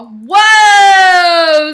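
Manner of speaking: loud, long-held whoa; big cartoon holler; startled yell. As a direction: shout it as if yanked off your feet. Hero yanked through the air.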